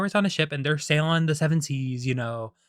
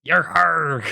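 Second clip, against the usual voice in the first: higher, loud hammed-up pirate cry; 4 semitones higher, 6 dB louder than usual. Shown as a demonstration. Vlogger locks into pirate mode, loud exaggerated cry. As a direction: push the pirate voice harder and higher. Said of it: "lock into my pirate voice".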